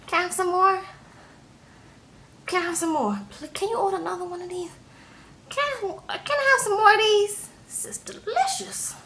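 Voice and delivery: high, squeaky tipsy pleading; small high-pitched drunken voice; tipsy woman. Retold or imitated. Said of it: "but she was gone".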